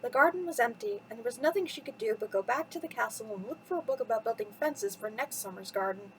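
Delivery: plain reading voice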